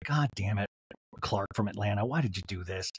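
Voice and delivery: low, soft grumble; muttered dismay. Retold or imitated. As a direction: curse the caller under your breath. Imagined Whitney cursing the caller.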